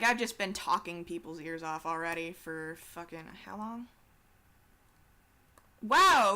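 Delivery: ordinary talk, nothing marked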